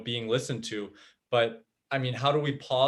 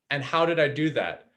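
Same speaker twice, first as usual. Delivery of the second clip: ordinary delivery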